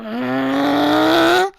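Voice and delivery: long loud scare yell, rising. Retold or imitated. Kid screaming at the group, loud rising screech. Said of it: "he gets louder, like, starts getting, turning more like to a man".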